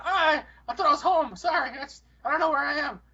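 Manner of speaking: feigned senile confusion; old and confused. Pretend. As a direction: plead bewilderment like a lost geezer. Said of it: "pretend that I'm fucking old and confused".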